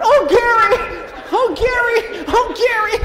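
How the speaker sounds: high, shrieking cries of a name; frightened shriek. Retold or imitated. Wife shrieking at husband from the house, mock panicked and high. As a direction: imitate a panicked wife calling out.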